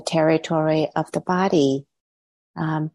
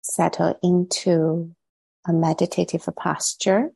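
guided meditation. Real, not acted. Teacher settling online meditators into posture and body, guided meditation. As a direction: ease them gently into stillness, leaving long pauses between phrases.

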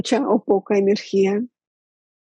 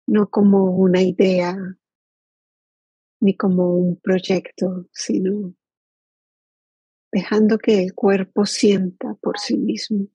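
guided meditation. Acted. Teacher inviting the group to settle into body and present moment, guided meditation. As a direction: lead them gently into stillness, leaving long silences.

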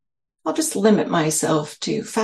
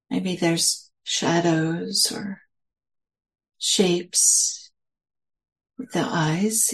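slow, spacious meditation guidance with long pauses; guided meditation. Real, not acted. Teacher settling listeners into their senses, slow guided meditation. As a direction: lead them gently inward, phrase by unhurried phrase.

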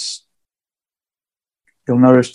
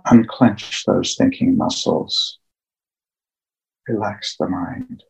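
slow, hushed meditation guide with long pauses; guided meditation; 7 dB quieter than usual. Acted. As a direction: ease listeners into calm, phrase by phrase, leaving long silences.

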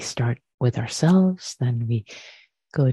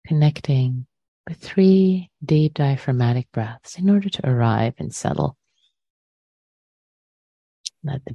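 unhurried meditation guidance with long pauses; guided meditation. Real, not acted. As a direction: guide listeners into the breath, leaving space after each phrase.

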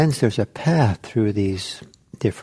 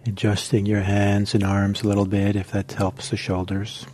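guided meditation. Acted. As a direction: ease the group into stillness, one gentle cue at a time. Teacher leads a guided meditation.

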